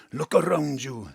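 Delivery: ordinary talk, nothing marked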